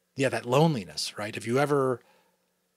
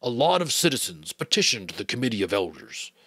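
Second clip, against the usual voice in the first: the giver, explaining; 4 semitones higher, 6 dB louder than usual. Acted. Giver figure speaks.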